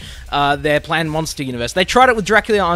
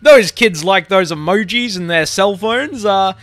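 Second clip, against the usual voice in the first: loud, higher, mock-clueless executive; hesitant but hammed-up boardroom enthusiasm; out-of-touch executives; 5 semitones higher, 5 dB louder than usual. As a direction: play a clueless old studio boss pitching. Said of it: "old, out-of-touch studio executives at Sony thinking".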